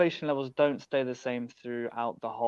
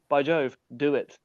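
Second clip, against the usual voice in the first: "by Jove" punched louder with "do it"; emphatic by Jove; 5 dB louder than usual. Shown as a demonstration. Urging learners to seize motivation, emphatic louder push. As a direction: hit "Jove" hard, then urge "do it". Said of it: "as we say in the U K".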